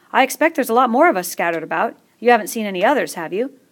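worried schoolboy asking; fat boy voice. Acted.

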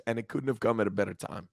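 ordinary talk, nothing marked

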